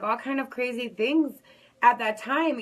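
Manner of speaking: normal talking voice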